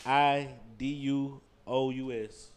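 slow letter by letter; spelling it out. Shown as a demonstration. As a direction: spell each letter slowly. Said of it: "spell that for me".

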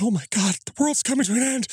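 high, panicked wail; freaking out. Retold or imitated. Man freaking out to his friend Bob, high panicked wail. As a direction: panic as if the world is ending. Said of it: "freaking out to Bob".

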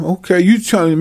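normal talking voice

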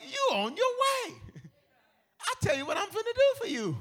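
Pharaoh voice. Retold or imitated. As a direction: boom it out like royalty. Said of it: "He's like".

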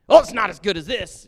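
loud, scoffing whine of a critic; mock whiny complaint. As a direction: sneer it out like a fussy critic.

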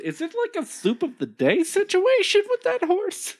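incredulous squeak. Real, not acted. Incredulous joke question.

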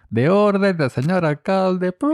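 high, slow proclamation voice; town crier. Acted. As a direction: proclaim it like a herald. Mock town crier announcement.

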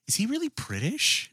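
high-pitched puzzled question; mock wifely query; imitating wife. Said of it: "my wife, she goes".

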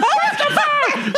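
long, drawn-out high mock scream of a name; screaming mom. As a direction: imitate Mom shrieking for her kid. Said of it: "I hear my mom screaming".

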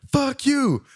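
high shrill yell at the driver; mimicking angry woman. Narrator voicing the woman yelling at the departing car, high mock shout. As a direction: squeal it like she's cussing him out.

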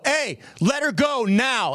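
acted-out stern command, drawn out; commanding call. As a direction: call out to stop someone, re-enacted. Acts out intervening from back there.

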